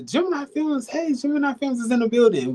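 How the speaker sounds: high, excited shout-out; big hype announcement. Real, not acted. Hyping up the production company.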